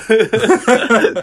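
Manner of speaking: loud, high burst of laughter; real laughter. Real, not acted. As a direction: laugh out, delighted by the story. Laughs at sweet gift story.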